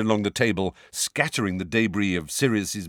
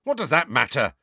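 hotly; 7 semitones higher, 3 dB louder than usual. A narrator's reading. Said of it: "said George hotly".

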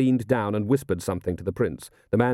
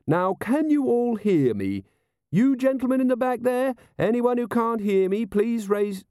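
high, carrying officer's call; calling to a crowd; 14 semitones higher than usual. A narrator's reading. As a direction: project earnestly to the back rows. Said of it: "Carrot's voice could be heard outside".

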